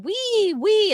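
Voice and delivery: put-on cooing wee to the baby; high playful wee. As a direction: parents swinging the baby around in the pool, gleefully. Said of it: "we're going".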